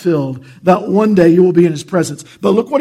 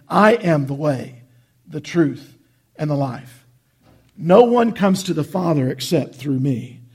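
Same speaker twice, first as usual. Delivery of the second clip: scripture reading; 3 semitones lower, 4 dB quieter than usual. Acted. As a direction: let each line of scripture land with weight. Reads John fourteen six.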